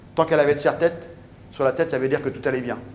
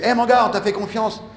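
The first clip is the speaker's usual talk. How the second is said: louder, higher shout of a supporter; yelled encouragement; 8 semitones higher, 5 dB louder than usual. Retold or imitated. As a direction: yell it at the struggling swimmer. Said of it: "ces gens qui me gueulent dessus".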